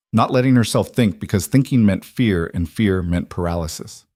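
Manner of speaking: ordinary delivery, nothing marked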